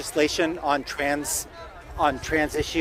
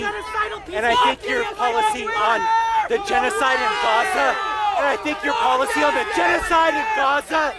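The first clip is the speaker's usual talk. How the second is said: rising shout; 10 semitones higher, 4 dB louder than usual. Real, not acted. Heckler escalates.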